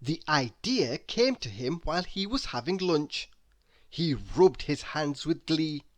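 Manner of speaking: usual speaking voice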